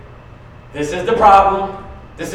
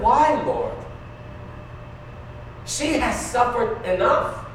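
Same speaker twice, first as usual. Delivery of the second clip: tearful plea; 3 semitones higher, 6 dB quieter, slower than usual. Retold or imitated. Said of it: "I said".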